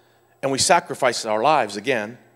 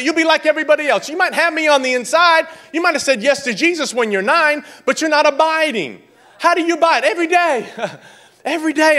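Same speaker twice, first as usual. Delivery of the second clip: high, louder urgent preaching; loud pulpit push; preaching peak; 12 semitones higher, 4 dB louder, faster than usual. Acted. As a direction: drive it home hard.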